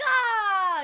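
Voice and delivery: startled cry. Retold or imitated. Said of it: "You're like".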